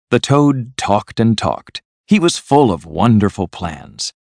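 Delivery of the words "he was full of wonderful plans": ordinary delivery, nothing marked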